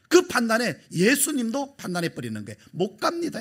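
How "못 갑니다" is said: high-pitched stubborn refusal; Peter protesting. Acted. Peter blocking Jesus's path, lightly voiced high refusal. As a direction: squeak out the stubborn no.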